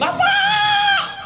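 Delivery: very high, loud screaming cry; terrified shriek. Acted. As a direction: scream for help in panic. Groom shrieks for his father-in-law.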